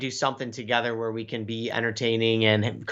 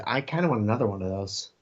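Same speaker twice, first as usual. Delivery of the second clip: ordinary talk, nothing marked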